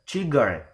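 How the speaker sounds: "cigarette" with last syllable RETTE punched; stress on RETTE in "cigarette". Shown as a demonstration. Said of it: "is given stress".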